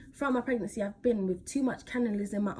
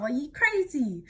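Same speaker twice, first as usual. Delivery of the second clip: ordinary delivery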